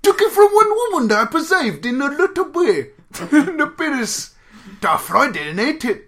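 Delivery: high, loud, garbled Cockney reading; Cockney accent. Acted. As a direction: declaim it in over-the-top Cockney. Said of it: "I'm reading it how it's originally intended".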